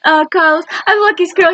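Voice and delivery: put-on high, bubbly, gushing girlfriend voice; excited Jane. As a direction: gush it out high and thrilled. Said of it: "she got all excited".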